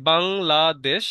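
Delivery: Bangladesh broken firmly into বাং, লা, দেশ; three separate beats. Shown as a demonstration. Teacher showing three pushes in the throat, slow বাং — লা — দেশ. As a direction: give Bangladesh slowly, a beat on each syllable. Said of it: "এটা হচ্ছে তিনবার".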